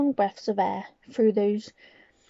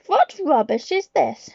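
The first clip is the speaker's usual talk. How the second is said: louder, slow scornful shout; 6 semitones higher, 6 dB louder than usual. Acted. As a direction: bellow with contempt. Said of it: "shouted the Grand High Witch".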